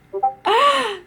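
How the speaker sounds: sudden shocked intake of breath; sharp gasp. Real, not acted. Viewer stunned by a plot twist, gasping.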